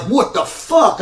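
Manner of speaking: incredulous high-pitched what the fuck; retold yell. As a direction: yell it in disbelief at being held in a choke. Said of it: "I'm yelling".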